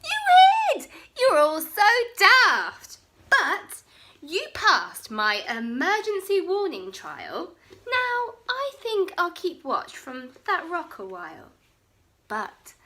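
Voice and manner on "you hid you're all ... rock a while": high, loud teasing; mocking. Bonehead teasing his friends for running, loud and gleeful. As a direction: gloat over the daft friends. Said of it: "Bonehead laughed".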